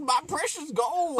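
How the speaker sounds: put-on old prospector voice. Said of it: "old man up in".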